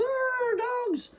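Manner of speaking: calling out very high for the dogs; a frightened boy yelling from a swaying tree; Wiley hollering for his dogs, pitched very high; hollering for help. Acted. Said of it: "starts hollering for his dogs".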